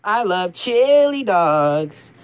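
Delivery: slow, stretched-out cartoon voice; slow drawn-out Sonic. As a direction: savour each word slowly. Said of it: "I'm Sonic the Hedgehog".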